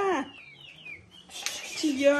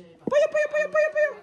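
high sing-song pollo calls; high chanted call; chicken call; 9 semitones higher, faster than usual. Real, not acted. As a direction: coax the hens over in a high voice. Calling chickens.

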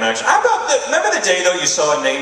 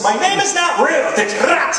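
higher, louder prissy correction; snooty correction; 4 dB louder than usual. Retold or imitated. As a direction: correct him like you are insulted. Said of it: "stuck-up variations".

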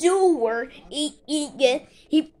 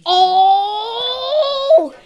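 high startled "Oh!" shout; alarmed cry; 9 semitones higher, 4 dB louder than usual. Pretend. Toy figure alarmed.

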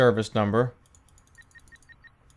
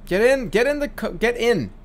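higher, insistent get in; impatient urging; 7 semitones higher than usual. Real, not acted. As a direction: hurry the passengers aboard. Urging passengers onto the bus.

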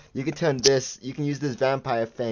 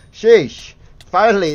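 loud; 7 semitones higher, 10 dB louder than usual. Real, not acted. Reacting to rare spawn.

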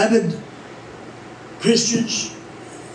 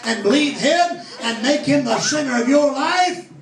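fervent sermon voice lifting on the promises; preaching; 2 semitones higher than usual. Acted. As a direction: preach it with rising conviction. Preaching to the congregation.

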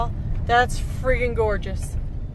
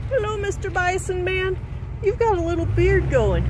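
higher, friendly voice for an animal; greeting a bison; 6 semitones higher than usual. Real, not acted. Driver greeting a bison beside the car, friendly pet talk.

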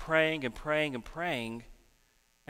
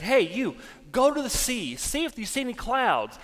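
raised-pitch order to a helper; acted-out call; 6 semitones higher than usual. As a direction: send the helper off on an errand. Prophet sends off his friend.

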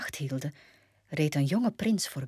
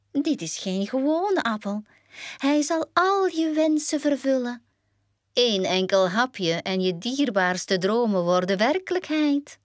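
hoge heksenstem die iets moois belooft; verleidende heks; 6 semitones higher than usual. Acted. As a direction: verkoop de giftige appel als een wonder. Heks prijst giftige appel aan. (hoge verleidende heksenstem)

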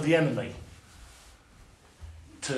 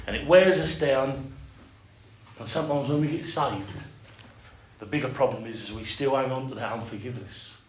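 normal talking voice

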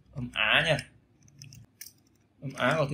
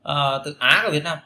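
ordinary talk

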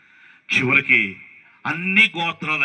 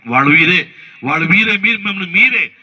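preaching shout; 2 semitones higher, 7 dB louder than usual. Real, not acted. Preacher calls out the congregation.

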